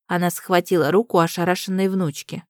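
plain reading voice

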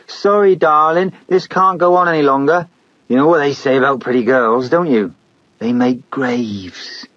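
high, sing-song mock tenderness; mocking sweetness. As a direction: taunt her sweetly before the kill. Killer mocks the girl.

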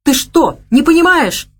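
high, loud exasperated snap; he jumps up irritated. A narrator's reading. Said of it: "в раздражении". (раздражённо)

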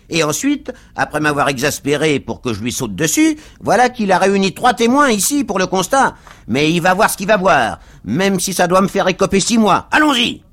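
high, loud rant building to a yell; worked-up cab driver. A narrator's reading. Cab driver tells the crowd he will fight, angry rant.

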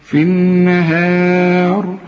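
slow chanted النهار, thin long "hae"; light prolonged h. Shown as a demonstration. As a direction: stretch النهار on a level note, keeping h light. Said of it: "والمد بتاعت الهاء برضو مرققة". (مد الهاء مرققة في النهار)

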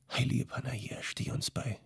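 breathless, voiceless prayer of dread; horrified whisper. A narrator's reading. Memetschik learns his people are gone, horrified whisper. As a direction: whisper it as if the ground gave way. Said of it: "rief Memetschik, zutiefst entsetzt".

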